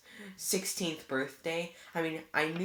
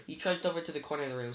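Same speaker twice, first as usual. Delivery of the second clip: plain reading voice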